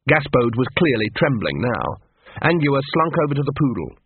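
plain reading voice

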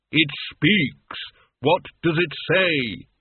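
slow, resonant voice of Death; Death, ponderous and imperious. Acted. Death addressing the mortal who dared speak, imperious.

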